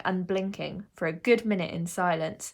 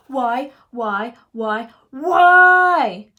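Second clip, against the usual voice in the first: building from firm to a high, drawn-out scream; angry, building; 4 semitones higher, 14 dB louder, much slower than usual. A narrator's reading. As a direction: hammer each one louder until it bursts. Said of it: "express how angry she was".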